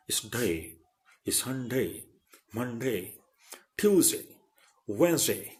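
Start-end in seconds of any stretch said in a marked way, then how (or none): none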